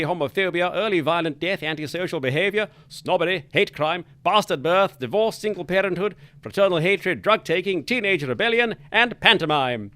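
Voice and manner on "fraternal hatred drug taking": ordinary talk, nothing marked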